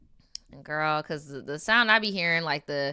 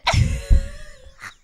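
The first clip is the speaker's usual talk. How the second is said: loud laugh; 7 dB louder than usual. Real, not acted. Reacting to a funny story.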